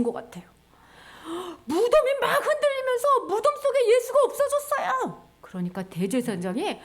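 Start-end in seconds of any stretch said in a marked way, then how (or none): 1.6-5.3 high-pitched, frantic guard; wailing alarm, pitched way up; panicked report. Retold or imitated. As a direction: blurt it out in acted terror. Guard reports the empty tomb in panic.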